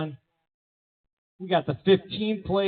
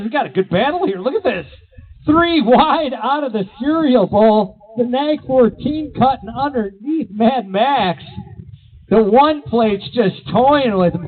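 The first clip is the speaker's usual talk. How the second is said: louder, higher, hyped up for a three-wide battle; excited race call; 5 semitones higher, 6 dB louder than usual. Real, not acted. BMX announcer to the crowd at a tight race, excited and loud. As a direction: sell the close finish, voice climbing.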